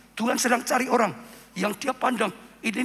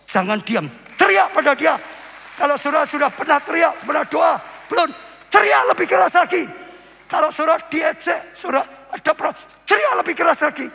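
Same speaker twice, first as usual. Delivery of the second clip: loud, high exhortation; full-force preaching; 8 semitones higher, 8 dB louder than usual. Real, not acted. Preacher urges congregation to cry out to Jesus, shouted exhortation. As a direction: shout to rouse the whole congregation.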